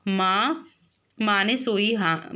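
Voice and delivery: ordinary delivery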